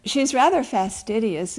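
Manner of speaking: ordinary delivery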